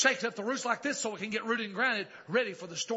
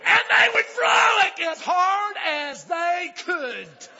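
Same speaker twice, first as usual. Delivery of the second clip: high, stretched, shouted exultation; full-force preaching; 12 semitones higher, 14 dB louder, much slower than usual. Real, not acted. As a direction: cry it out in praise to the whole church.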